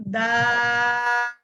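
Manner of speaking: "দাল" vowel stretched out very long and slow; long-held dal. Shown as a demonstration. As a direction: hold the vowel of "দাল" for the full count, no air escaping. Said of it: "বলেন আমার সাথে".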